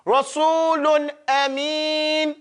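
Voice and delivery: Rasulun Ameen stretched slowly, recited; slow recitation. Shown as a demonstration. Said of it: "Quran recitation example".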